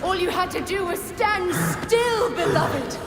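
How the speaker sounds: high, strained angry cry; high-pitched scolding outburst; furious villain. Acted. Villain attacking in a fight.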